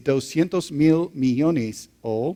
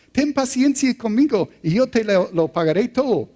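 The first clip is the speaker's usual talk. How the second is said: begging servant, voice pitched much higher; pleading servant; 9 semitones higher, 3 dB louder than usual. A narrator's reading. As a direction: plead desperately for patience. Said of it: "le suplicaba".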